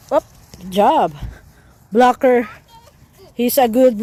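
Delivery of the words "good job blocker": normal talking voice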